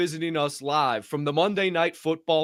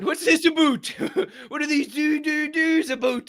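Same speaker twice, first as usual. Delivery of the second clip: high mock officer voice; hammed-up high customs officer; 11 semitones higher than usual. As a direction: quiz like a baffled border guard. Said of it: "they go".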